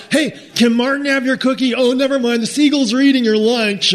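put-on high, loud teasing kid voice; taunting boys. Boys teasing Josh locked in the outhouse, high and loud. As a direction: yell mock offers through the door. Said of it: "they're like".

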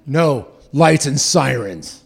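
slow, loud, deliberate insistence; stubborn refusal. Retold or imitated. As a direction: spell out the rule like it's obvious.

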